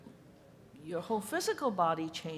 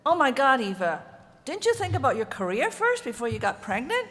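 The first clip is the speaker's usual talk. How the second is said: much louder, retold disbelief; incredulous friend; 5 semitones higher, 10 dB louder than usual. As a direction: belt out a friend's shocked question. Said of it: "one of my friends said".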